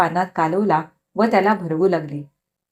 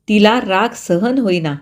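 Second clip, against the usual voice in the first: plain reading voice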